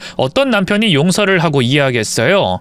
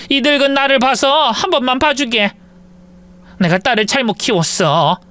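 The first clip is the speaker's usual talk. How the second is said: put-on higher, begging older mother-in-law; 8 semitones higher than usual. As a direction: plead on your knees as an old mother. Mother-in-law begs on her knees.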